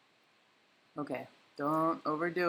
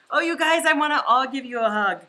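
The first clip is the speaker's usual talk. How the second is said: gushing, louder and higher; overjoyed, want to hug everyone; excited; 7 semitones higher, 6 dB louder than usual. Real, not acted. Thanking many viewers.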